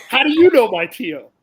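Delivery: a jockey stunned that a stranger knows his uncle; amazed question. Retold or imitated. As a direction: ask it like you can't believe it. Jose amazed, quoted.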